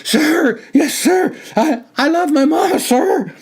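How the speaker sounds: very high, loud desperate shout; terrified recruit shouting back; shouting with all his breath. A narrator's reading. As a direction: yell it as loud as your lungs allow. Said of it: "shouted out as loudly as his oxygen-depleted lungs would allow".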